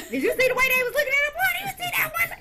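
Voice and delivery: high, fast, loud laughing gossip voice; mock gossip. Retelling car gossip after Walmart prank, high fast laughing. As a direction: gush about the shoppers, hammed up. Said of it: "talk about people after that".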